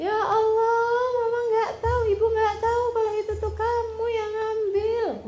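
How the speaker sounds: high-pitched wailing mother; acted distraught wail. Mother learning her own child took it, acted wail. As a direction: act out a mother crying out in dismay.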